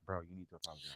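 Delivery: very soft, low imitated whisper; retold whisper. Elijah Wan nudging her to apologize, imitated in a whisper. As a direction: imitate a confidential whisper, barely audible. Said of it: "is whispering to her like".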